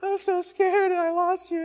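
high, loud mock wailing; hammed-up sobbing mother, overcome with relief; imitating crying mom. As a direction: wail it in a high put-on voice. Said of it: "and she's crying".

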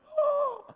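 a sudden high gasp of scandal; sharp mock gasp. Preacher feigning the crowd's shock: sharp gasp.

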